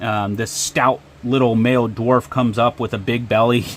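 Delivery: ordinary delivery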